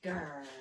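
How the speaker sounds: rumbling grrr; lion growl. A narrator's reading.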